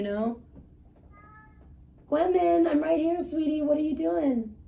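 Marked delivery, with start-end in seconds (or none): 2.1-4.5 high, sing-song voice for the cat; sweet cat talk. Real, not acted. Talking sweetly to her cat.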